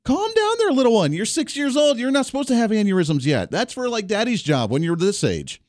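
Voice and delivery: gently mock-scold the little one; teasing dad. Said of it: "I'm like".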